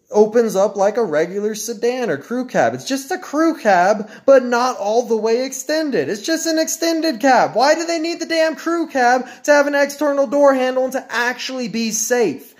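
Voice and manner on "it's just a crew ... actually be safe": high, heated complaint climbing; mounting rant. Real, not acted. Ranting to viewers about the cab doors, rising high and heated. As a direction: build the frustration.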